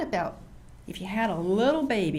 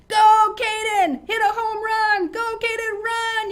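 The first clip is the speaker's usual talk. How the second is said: high, full-voice ballgame holler; loud, drawn-out cheering from the stands; yelled cheer; 12 semitones higher, 9 dB louder, slower than usual. Shown as a demonstration. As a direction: yell it right out to the batter. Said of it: "you gotta yell it right out".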